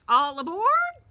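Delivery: high, loud call down the bus; bus driver calling. A narrator's reading. Bus driver calls the kids aboard, loud and high. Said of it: "I heard Miss Victoria say".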